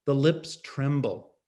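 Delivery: plain reading voice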